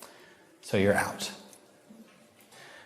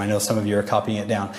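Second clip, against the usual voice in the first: usual speaking voice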